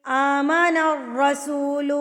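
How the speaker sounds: slow melodic tajweed chant; Quran recitation. Acted. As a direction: draw out each vowel in recitation. (آہستہ ترتیل کے ساتھ تلاوت)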